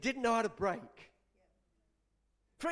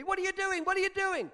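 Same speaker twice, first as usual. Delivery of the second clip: high, raised, urgent protest; alarmed anger; 9 semitones higher, 5 dB louder than usual. Acted. Drinker running at the man pouring away his beer, alarmed anger. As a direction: cry out in agitated protest. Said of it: "He got quite angry".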